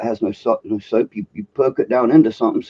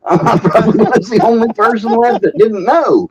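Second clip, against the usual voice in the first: higher and louder, laughing through the words; 11 semitones higher, 5 dB louder than usual. Real, not acted. Guest laughing at his own ignorance, real laughter. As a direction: laugh at yourself while admitting it. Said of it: "you guys are laughing at me".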